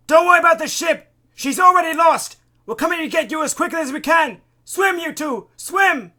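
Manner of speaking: high, urgent shouting across water; calling out. A narrator's reading. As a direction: yell encouragement to distant swimmers. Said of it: "Isaac calls out to the two people".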